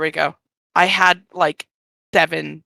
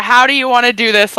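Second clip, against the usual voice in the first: quoting the DM; 5 semitones higher, 5 dB louder than usual. Retold or imitated. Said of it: "she got a".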